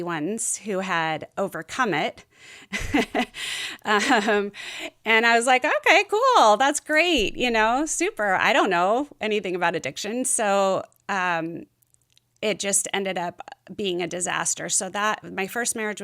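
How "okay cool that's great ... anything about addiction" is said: high, bright mock-cheerful okay cool; overly upbeat acceptance; naive enthusiasm. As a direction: play the clueless, chirpy wife, much higher and louder.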